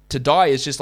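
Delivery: usual speaking voice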